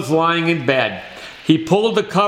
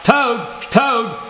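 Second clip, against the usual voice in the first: higher, mildly excited storybook call; crying out; 4 semitones higher than usual. A narrator's reading. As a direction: call Toad's name without really shouting. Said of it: "cried Frog".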